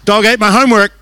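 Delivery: loud, high, laughing schoolgirl blurt; blurted excuse. Retold or imitated. Girl put on the spot with a microphone, loud laughing blurt. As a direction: yell the excuse in a panic. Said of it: "she went".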